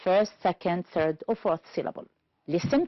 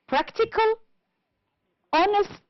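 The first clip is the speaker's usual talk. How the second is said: "practical, honest" high, each stressed at the start; stress on first syllable; 12 semitones higher than usual. Shown as a demonstration. Teacher listing words for the table, "practical, honest" stressed on first syllables. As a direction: pitch up PRAC in "practical", HON in "honest". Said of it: "first syllable".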